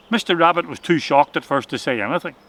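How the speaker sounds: ordinary delivery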